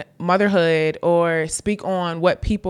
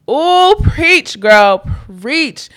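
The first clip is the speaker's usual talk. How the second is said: hype cheer; 7 semitones higher, 7 dB louder, much slower than usual. Real, not acted. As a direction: cheer her on like church. Cheering on a quoted tweet.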